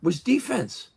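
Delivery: put-on high insistent old trainer; quoting old trainer. Old trainer drilling the boy on defense, high insistent voice. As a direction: drill it in like a gym coach. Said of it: "he goes".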